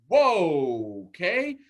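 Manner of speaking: taken aback by a sudden outburst; startled. Retold or imitated.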